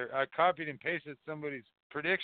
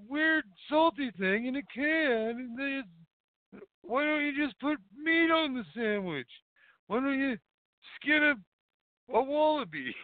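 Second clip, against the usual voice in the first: mock high ranting voice; 10 semitones higher than usual. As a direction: rant like a silly high-voiced caricature about Marmite. Mock ranting voice about Marmite.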